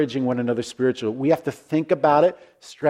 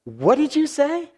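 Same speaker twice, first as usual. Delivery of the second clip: surprised question; 12 semitones higher than usual. Retold or imitated. Stranger stops, surprised.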